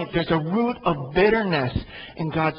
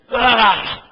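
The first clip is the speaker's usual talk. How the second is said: frustrated cry; 6 semitones higher, 7 dB louder than usual. Real, not acted. Frustrated outburst in sermon.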